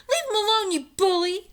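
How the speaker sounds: high loud angry yell; angry cry. A narrator's reading. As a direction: scold the pirate fiercely. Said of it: "she cried".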